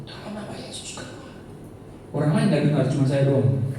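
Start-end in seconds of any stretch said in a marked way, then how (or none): 0.1-1.3 barely audible whispered command; whispering. Shown as a demonstration. Teenager casting out a spirit into a friend's ear, whispering. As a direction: whisper it so nobody else hears. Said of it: "Sambil berbisik".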